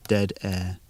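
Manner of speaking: usual speaking voice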